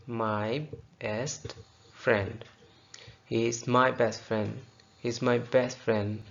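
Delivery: friend said slowly as frɛn with no d at the end; dropped d. Shown as a demonstration. Teacher models friend without the d. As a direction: drop the d in friend, very slow. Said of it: "फ्रेंड का डी नहीं पढ़ना".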